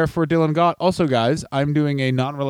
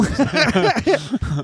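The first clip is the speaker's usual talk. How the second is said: laugh; 8 semitones higher than usual. Real, not acted. Laughing at own line.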